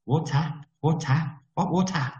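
high, puzzled Malaysian English; clipped confused Malaysian waiter; imitating a Malaysian accent. Waiter not understanding the tourist, in Malaysian accent. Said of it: "retold waiter".

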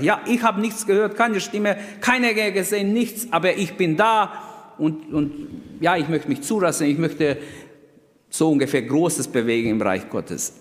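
mock the easy, careless answer; flippant classmate. Preacher imitating the flippant classmate, light and careless. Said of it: "Der hat so leicht geredet".

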